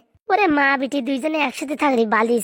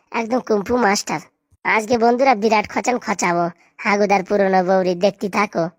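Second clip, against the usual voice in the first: sly aside; 3 semitones lower than usual. Acted. Scheming aside to viewers.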